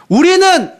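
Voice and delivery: high, drawn-out acted complaint; grumbling wail. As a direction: start a despairing people's grumble high. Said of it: "원망하고 막 불평하고".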